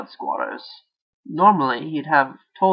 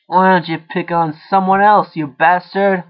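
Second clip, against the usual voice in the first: loud, furious rant; 4 semitones higher, 8 dB louder than usual. A narrator's reading. Jake raging at the voice that torments him. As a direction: spit it at the voice. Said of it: "Jake ranted".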